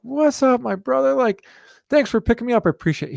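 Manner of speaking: cheerful imitation of an older man's greeting; John Carlos greeting. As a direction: pitch way up for a big hearty hello.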